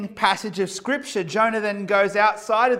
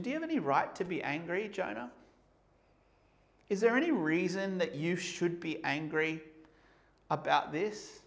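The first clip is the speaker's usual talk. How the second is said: soft, gentle, probing ask; softer and gentle, asking tenderly; kind, polite question; 7 dB quieter than usual. Retold or imitated. God gently asking Jonah, in a soft, probing voice. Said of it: "kindly and politely asks".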